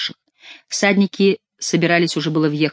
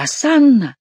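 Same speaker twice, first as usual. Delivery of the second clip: high exclamation, swooping down; crying out; 9 semitones higher, 4 dB louder, slower than usual. A narrator's reading. Chicot cries hosanna to the sky, crying out. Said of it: "крикнул Шико".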